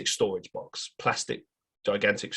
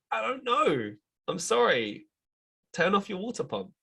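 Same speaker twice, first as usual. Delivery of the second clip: flustered; 10 semitones higher than usual. Retold or imitated. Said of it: "And I'm like".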